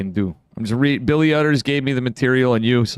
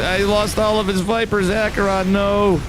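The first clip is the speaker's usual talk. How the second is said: dismayed cry; 8 semitones higher than usual. Real, not acted. Caster sees Vipers all die.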